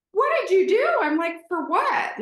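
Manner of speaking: high, loud shocked question; reliving the shock of the news; shocked disbelief. Retold or imitated. Shocked at husband's arrest news.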